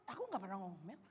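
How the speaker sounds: low, hushed protest; meek mock denial. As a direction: deny it under your breath. Acts out the accused spouse's denial. (membantah pelan dengan nada rendah)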